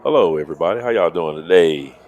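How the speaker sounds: booming radio host greeting; big announcer welcome to the stream. Acted. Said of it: "Listen to that radio voice".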